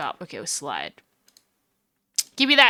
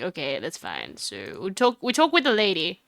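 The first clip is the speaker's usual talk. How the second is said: ordinary talk, nothing marked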